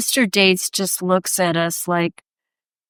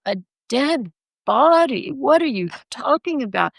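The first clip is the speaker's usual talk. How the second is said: incredulous; 4 semitones higher than usual. Retold or imitated. Artist turning on the policeman in her story, incredulous. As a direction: question it in retold astonishment, pitched up.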